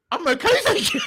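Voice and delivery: high, loud squeaky put-on reply; laughing mock refusal. Retelling Grayson turning Isaac down, laughing mock refusal. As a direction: crack up while imitating an awkward brush-off.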